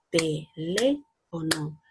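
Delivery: very slow, "Teléfono" split into te-lé-fo-no, one clap per syllable; clapped out slowly. Shown as a demonstration. Teacher claps out "Teléfono" for the children, very slowly. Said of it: "Pal-, palmaditas".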